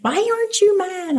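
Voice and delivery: put-on high-pitched kid's demand; frustrated son. Mom imitating her son, high frustrated kid voice. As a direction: pitch it up like an upset child. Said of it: "he's like".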